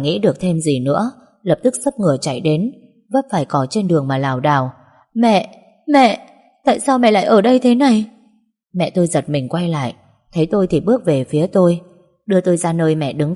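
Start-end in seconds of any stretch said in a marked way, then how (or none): 5.1-8.1 loud, high, distressed calling out; gọi mẹ. Acted. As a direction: cry out to a mother just found. Runs stumbling to missing mother, calling. (giọng gọi mẹ thảng thốt, to và cao)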